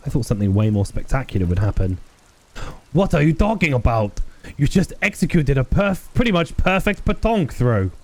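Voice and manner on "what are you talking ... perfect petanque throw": high-pitched mock French admiration; terrible French accent. Old player admiring the officer's throw, in a terrible French accent. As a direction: gush in an exaggerated, badly faked French accent. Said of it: "terrible French mockery accent".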